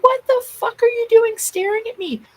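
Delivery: high, loud enraged shout at a peeping worker; furious yell. Retold or imitated. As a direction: scream it out, losing it completely. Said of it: "And I lost it".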